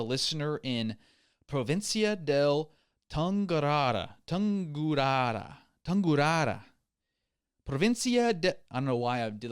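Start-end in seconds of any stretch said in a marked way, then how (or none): 1.5-6.6 hammed-up Italian accent, rolling Tungurara; mock Italian accent. Podcast host butchering an Ecuadorian province name in mock Italian. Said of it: "like, an Italian right there". 7.6-8.5 Provincia de sung high and loud in a put-on Italian accent; mock Italian accent. Host reading a listener's province in hammed-up Italian. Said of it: "like, an Italian right there".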